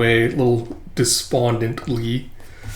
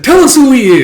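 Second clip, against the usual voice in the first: loud demand; 12 semitones higher, 10 dB louder than usual. Retold or imitated. Interrogation demand.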